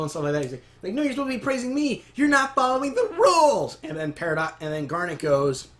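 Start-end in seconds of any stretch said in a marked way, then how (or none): 0.9-3.8 high mock whine building louder; indignant protest. Peridot to those praising Pearl, indignant protest. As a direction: complain high, peaking on the rules.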